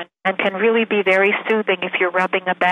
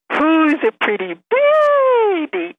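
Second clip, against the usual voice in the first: put-on high, slow baby talk with long vowels; exaggerated sing-song voice for talking to a baby; motherese; 9 semitones higher, 4 dB louder, much slower than usual. Shown as a demonstration. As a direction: coo it way up high, vowels stretched out. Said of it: "using what we call motherese".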